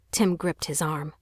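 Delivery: ordinary delivery, nothing marked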